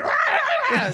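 very high-pitched strangled yowl; Bobcat Goldthwait howl. Shown as a demonstration. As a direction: screech it as a signature comic roar. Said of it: "horrible Bobcat Goldthwait impression".

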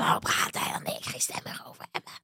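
voiceless whispery croak, very soft; imitated lost voice. Friend imitates partying girlfriends whose voices are gone, hoarse voiceless whisper. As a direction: push the words out with no voice left. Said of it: "Die dan helemaal schor zijn".

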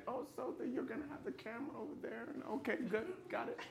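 Lincoln voice. Retold or imitated. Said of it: "as Abraham Lincoln said".

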